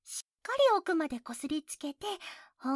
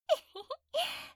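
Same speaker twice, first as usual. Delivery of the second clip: teasing giggle; 10 semitones higher than usual. Acted. Mocking giggle after teasing.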